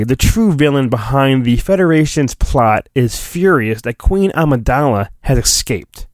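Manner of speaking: ordinary talk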